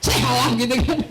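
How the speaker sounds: chuckling through the words; laughing. Real, not acted. Preacher laughing at himself before the congregation. Joking about being called sheikh.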